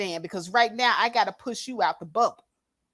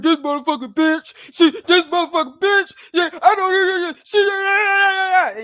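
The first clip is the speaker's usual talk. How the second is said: loud, high, strained mocking rant; mock tough talker; 9 semitones higher, 8 dB louder than usual. Vlogger mocking critics who rant on panels, loud mock tough talk. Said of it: "they got a deep voice and they can talk like this".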